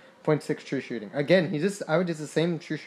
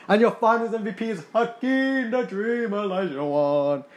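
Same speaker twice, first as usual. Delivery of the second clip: mock announcer; 6 semitones higher than usual. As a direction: boom it out like a PA announcer. Announcing finals MVP after title.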